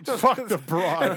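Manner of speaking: loud, high, cracking up; laughing. Real, not acted. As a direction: repeat it through helpless laughter. Cracks up repeating friend's words.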